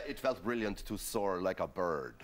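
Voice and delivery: slightly put-on, measured quote of a birdman; deliberate, drawn-out mock solemnity; retold Swiss birdman. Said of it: "he said".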